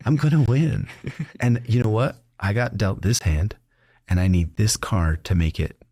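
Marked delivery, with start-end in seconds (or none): 0.1-0.9 excited boast. Retold or imitated. Repeats louder, higher.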